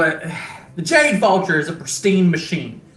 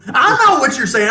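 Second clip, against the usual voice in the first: indignant shout; 8 semitones higher, 3 dB louder than usual. Acted. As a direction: snap at them for winking. NPC scolds a wink.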